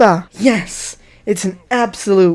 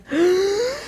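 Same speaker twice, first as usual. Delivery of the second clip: mock gasp; 13 semitones higher, 4 dB quieter than usual. Fake shock at own question.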